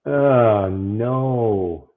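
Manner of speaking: ordinary talk, nothing marked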